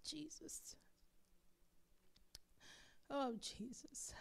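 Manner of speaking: breathy whisper, almost no voice; whispered prayer. Real, not acted. Pastor to the congregation, breathing Jesus in a whisper. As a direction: breathe the name in a hush.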